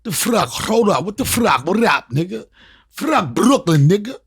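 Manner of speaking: loud hyped Brooklyn yell; Brooklyn coming out. Retold or imitated. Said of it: "My Brooklyn started coming out".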